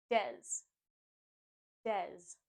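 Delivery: Des alone, as "dez"; stressed syllable alone. Shown as a demonstration. Teacher drilling the first syllable, firm Des. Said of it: "put the stress on the first syllable".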